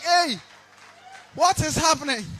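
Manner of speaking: high, loud cry of bafflement; imam's startled shout. Acted. Imam reacting to the speaker, high baffled shout. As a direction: shout it out in alarm. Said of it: "Imam was like".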